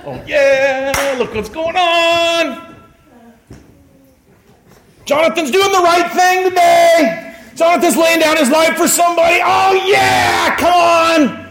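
high, drawn-out whooping cheer; cheering angels. Acted. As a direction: cheer like a rowdy fan in heaven's stands. Acting angels cheering in heaven.

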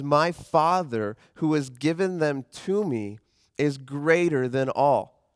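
slow and rising, with weight on greater; slow scripture reading. Acted. Pastor reading Jesus' claim about the Father, slow and weighty. Reads the comparison slowly.

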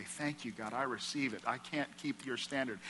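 soft, humble acceptance; hushed, thankful surrender; humble quiet thanks. Pretend. People accepting God's grace, soft humble thanks. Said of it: "people were supposed to say".

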